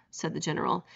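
ordinary delivery, nothing marked

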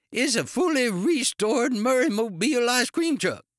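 high, loud character rant; indignant outburst. A narrator's reading. Ice cream man telling officer what he wrecked, outraged. Enraged owner boasts of wrecked truck.

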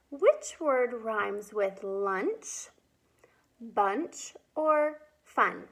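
slow, drawn-out question; slow teacher question. Shown as a demonstration. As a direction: ask it slowly for children. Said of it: "Time for rhyming".

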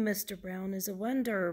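lively sing-song picture-book reading; reading to children. Acted. Woman reads Mister Brown book to children, lively storytelling. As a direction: read it with big swoops of pitch.

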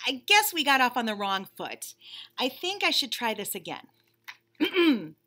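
lightly voiced bunny, impatient and fed up; a put-upon bunny stuck waiting; bored bunny. Bunny complaining to the reader about waiting, mildly whiny.

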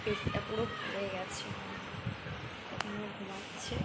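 speaking softly. Real, not acted. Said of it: "আমি আস্তে আস্তে কথা বলছি". (বাচ্চা ঘুমাচ্ছে বলে চাপা গলায় কথা)

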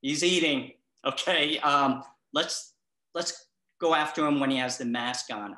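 mock virus commander ordering troops, loud and high. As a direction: bark it like a virus general rallying germs. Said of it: "the virus looks at you ... and say".

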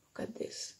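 put-on hushed aside to the children; whisper. Shown as a demonstration. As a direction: whisper it like sharing a secret. Teacher whispers aside to kids.

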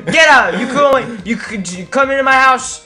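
high, loud blustering imitation; mock scolding. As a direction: stumble through a hammed-up telling-off. Mock householder throwing someone out.

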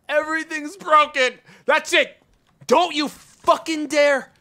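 loud, high exasperated yelling; angry shout. Real, not acted. Streamer losing it at the broken store game, angry shout. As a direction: blow up at the game, the last words slow and threatening.

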